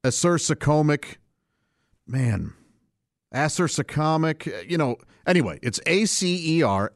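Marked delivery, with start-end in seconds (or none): 0.0-1.2 acersocomic said uh-SER-suh-COME-ic, long o; chosen pronunciation. Shown as a demonstration. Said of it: "so I'm gonna say". 3.3-4.7 acersocomic drawn out slowly as AS-er-suh-COM-ic; alternative pronunciation. Shown as a demonstration. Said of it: "had a different way to pronounce it".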